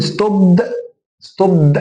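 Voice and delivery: "ستوب" ending in a heavy voiced d-uh; wrong D ending. Shown as a demonstration. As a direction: tack a hard, voiced D onto the end of "ستوب". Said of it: "لا، ما اقولش ستوب".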